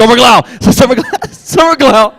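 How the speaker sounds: high, loud, laughing cry of the name; excited outburst. Real, not acted. Guest finally recalls the actress's name, excited and laughing. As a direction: blurt the name out in happy relief.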